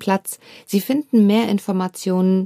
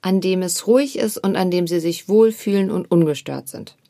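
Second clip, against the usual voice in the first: guided meditation. Acted. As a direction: guide the listener gently into stillness. Leads a meditation.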